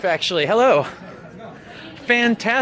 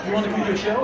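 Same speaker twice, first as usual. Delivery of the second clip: bright, upbeat friendly invite; 5 semitones higher, 3 dB quieter than usual. Retold or imitated. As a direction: pitch it cheerfully to a passerby. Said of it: "you say".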